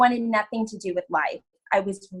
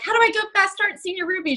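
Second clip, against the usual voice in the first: much higher, breathless eagerness; eager high-pitched quote; 10 semitones higher, faster than usual. Retold or imitated. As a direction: replay the rush to rank up. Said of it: "I messaged Roz and was like".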